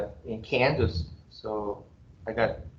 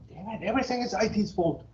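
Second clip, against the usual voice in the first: higher, grumbling mock rant at IT; mock curse at IT; 8 semitones higher, 3 dB quieter than usual. Professor fakes a rant at the IT staff, mock grumbling. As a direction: blame IT in a jokey grumble. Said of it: "I say terrible words against I T people".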